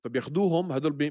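ordinary delivery

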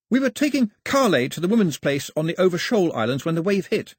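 put-on high-pitched, urgent young newcomer; distressed young man. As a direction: explain the disaster urgently.

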